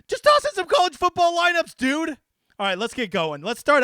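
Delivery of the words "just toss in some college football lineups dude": high, loud hype; hyped pitch. Real, not acted. Host urging NFL players to try college lineups, hyped. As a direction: sell it like a pitchman.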